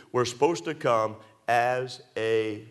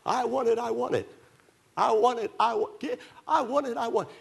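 put-on high whiny kid begging over and over; whining child; 8 semitones higher than usual. Kid in a Walmart whining at his parents, high whine. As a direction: tug at the parent's shirt and whine. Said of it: "He goes up to daddy".

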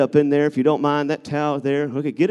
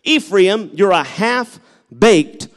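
rising preacher's cry, loud; fiery preaching; 3 semitones higher, 4 dB louder than usual. Acted. Preacher warns congregation against half-baked faith, fiery. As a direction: drive the point home to the congregation.

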